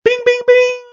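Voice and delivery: very high ringing bing; high, sustained ding on one note; imitated match bell. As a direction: mimic a ringside bell. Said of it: "And then we hear".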